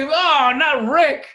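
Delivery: high, loud protest on someone's behalf; mock dismay. Comic imitating people defending Rick, loudly dismayed.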